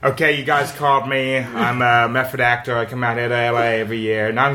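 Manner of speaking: very low American actor voice; put-on American accent. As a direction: drop deep into a mock method-actor American accent. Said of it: "Is this your American accent?".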